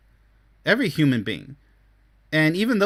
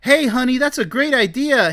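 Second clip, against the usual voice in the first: loud, high-pitched burst of enthusiasm; excited partner; 9 semitones higher, 4 dB louder than usual. Acted. Partner excited in conversation with honey, loud enthusiasm. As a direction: act out being thrilled by a partner's idea. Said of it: "the same with conversation".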